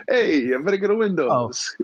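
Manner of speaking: a quick mock Italian plumber voice; Mario-style Italian accent. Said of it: "it sounded like Mario a little bit".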